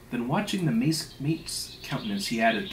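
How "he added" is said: ordinary delivery, nothing marked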